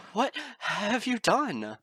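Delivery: high, loud and slow, trembling with fear; quivering dread. Acted. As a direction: let the horror shake it out slowly. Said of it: "her lips quivering".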